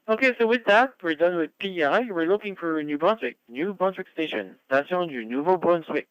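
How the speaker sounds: high, loud radio call-out; net control announcer. Real, not acted. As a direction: call the next stations on air. Net control calls next province.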